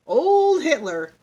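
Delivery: high, loud, drawn-out schoolboy recital; boy announcing to the whole class; reciting schoolboy. A narrator's reading. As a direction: launch into a class report, slow and loud. Boy begins current event aloud.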